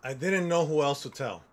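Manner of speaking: ordinary talk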